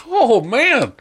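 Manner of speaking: high, gushing exclamation; mock excitement. Retelling own reaction to co-host, excited. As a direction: let it swoop up like a thrilled fan. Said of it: "I got a little bit excited".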